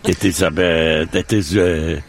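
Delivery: mock French accent, deliberate. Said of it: "two French men".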